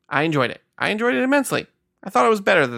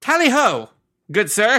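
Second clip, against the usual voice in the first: high, hammed-up British toff; mock British; 8 semitones higher, slower than usual. Cohost riffing on the British reading in a mock toff accent. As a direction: toss off a jokey posh British greeting. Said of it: "that's why you said British".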